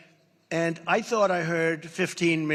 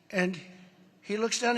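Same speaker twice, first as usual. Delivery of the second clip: normal talking voice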